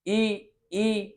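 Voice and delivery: ई sustained as a tongue-position demo; demo vowel. Shown as a demonstration. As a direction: stretch ई out so they feel the tongue forward. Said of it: "जैसे ई बोलो".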